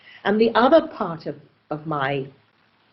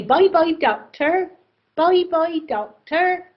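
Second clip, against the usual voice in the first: put-on high, singsong Oxford accent goodbye; lilting Oxford accent farewell; Oxford local accent; 9 semitones higher than usual. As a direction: imitate Oxford patients, pitched well up. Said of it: "That's the music there".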